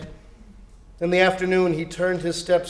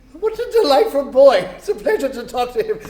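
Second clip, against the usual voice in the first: higher, louder then quick and laughing; delighted chuckle; 7 semitones higher, 5 dB louder than usual. Acted. Scrooge delighted by the boy, laughing. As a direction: beam with delight.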